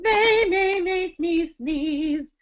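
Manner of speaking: high, slow, sung-out silly line; funny and silly. Shown as a demonstration. Teacher demonstrating a silly buzzy sentence. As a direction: sing it playfully silly. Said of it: "it's sort of funny and silly".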